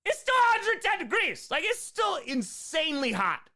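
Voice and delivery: hammed-up outrage at the heat; retold exasperated protest. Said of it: "And I was like".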